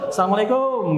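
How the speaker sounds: high, run-together Indonesian "salamlekom"; jokey local Indonesian greeting with long final vowel; Nusantara-style greeting. Shown as a demonstration. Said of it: "Kalau salam Nusantara gimana?". (salam logat Nusantara, tinggi dan memanjang)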